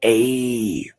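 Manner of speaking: "A" held long as "ayyy"; long A sound. Shown as a demonstration. Teacher explaining the letter, "A" drawn out. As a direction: stretch "A" into a long "ay". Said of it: "A sound".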